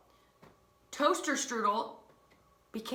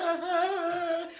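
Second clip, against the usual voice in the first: long, very high mock wail; panicked wail; 9 semitones higher, 4 dB louder than usual. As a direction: imitate a kid wailing in alarm. Panicked brother pointing at microwave.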